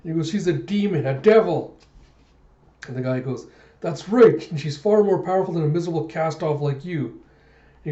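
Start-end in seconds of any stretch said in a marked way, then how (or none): 0.3-1.8 high, anguished cry building louder; hammed-up distraught accusation; acted tearful outburst. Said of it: "Zapan just starts crying". 3.8-7.1 high, loud, rapid jeer; hammed-up scornful put-down; acted taunt. Guy taunts the crying Zapan.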